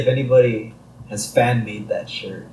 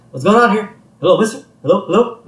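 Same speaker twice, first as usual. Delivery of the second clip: higher, insistent calling into a silent line; puzzled phone caller; 8 semitones higher, 3 dB louder, faster than usual. Retold or imitated. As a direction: act out a baffled rabbi seeking an answer. Puzzled rabbi voiced on silent call.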